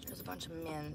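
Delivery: usual speaking voice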